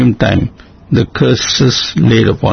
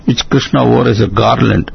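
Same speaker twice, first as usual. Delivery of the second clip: plain reading voice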